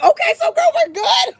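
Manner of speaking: very high squealed reassurance; high-pitched, excited retold outburst; giddy mock relief. Retold relief after license check.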